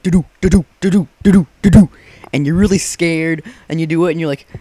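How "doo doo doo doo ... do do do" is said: higher, louder tense heartbeat hum; nervous doo-doo hum. Retold or imitated. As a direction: hum the suspense like your heart is pounding.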